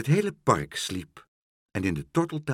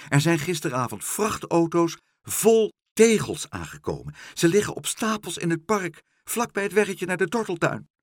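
high, loud, excited telling; excited report; 8 semitones higher, 4 dB louder than usual. A narrator's reading. Dolly tells of trucks full of tiles, excited. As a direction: rush out the alarming news in a high voice. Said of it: "Ze was erg opgewonden".

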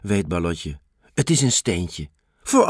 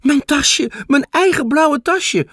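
higher and louder, thrilled; happy; 12 semitones higher, 4 dB louder than usual. A narrator's reading. Girl spots her lost blue bag, high joyful. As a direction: burst out with joy at finding it. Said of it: "denkt Balotje blij".

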